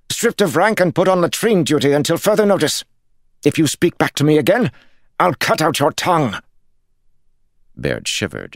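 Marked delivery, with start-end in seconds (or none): 0.1-6.4 much higher, louder, fast threats; furious tirade. A narrator's reading. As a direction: rattle off the punishments in rage. Lord threatens guard.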